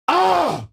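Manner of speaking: sudden high, loud scream of pure frustration; frustrated scream. Real, not acted. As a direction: let out a real yell of rage at the phone. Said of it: "It makes me so mad".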